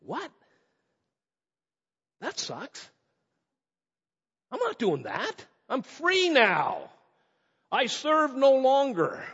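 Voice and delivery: mock indignant protest, stretched out; indignant refusal. Freed slave told to be a slave again, acted indignant. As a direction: act out a freed man balking. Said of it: "He's going".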